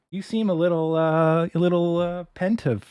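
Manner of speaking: high condescending doctor voice, hesitating; mocking doctor voice. Doctor telling the patient he seems pensive, high mock voice. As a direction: talk down like a patronizing doctor.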